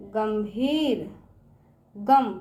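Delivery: गंभीर drawn out very slowly; slow classroom sounding-out. Shown as a demonstration. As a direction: stretch गंभीर for the children. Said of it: "teacher sounds out word".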